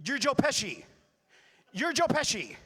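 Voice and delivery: high, loud accusing shout; imitating a pushy fan yelling; aggressive fan. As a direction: holler it insistently at the stage. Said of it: "but kind of aggressive".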